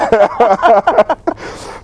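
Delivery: high, loud giggling laugh. Real, not acted. Guest laughing about his aunt's comment, high and loud. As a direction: let a high laugh spill out. Laugh after aunt story.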